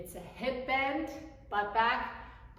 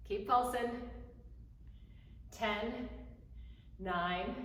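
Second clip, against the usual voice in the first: normal talking voice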